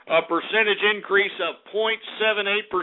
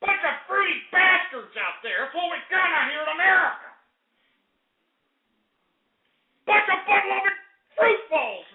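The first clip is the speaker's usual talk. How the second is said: high ranting; 10 semitones higher than usual. Acted. Host railing at his viewers, high-pitched rant. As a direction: keep railing at them, pitch way up.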